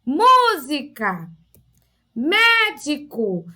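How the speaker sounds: slow "Música", "médico" in syllables, MÚ and MÉ strongest; first syllable stressed. Shown as a demonstration. Teacher showing pupils the tonic syllable, slow with MÚ and MÉ stressed. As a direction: stretch the first syllable, let the rest fall. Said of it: "quando eu separo as sílabas".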